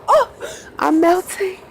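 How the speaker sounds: a high, dreamy sigh of a line; lovestruck swoon. Retold or imitated. On the phone hearing his accent, a high smitten swoon. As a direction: melt like a smitten teen, up high.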